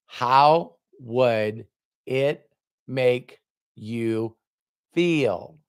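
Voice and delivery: very slow, word by word; drawn-out question. Retold or imitated. Mentor asking Robert to imagine, very slow and deliberate. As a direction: space out each word for weight.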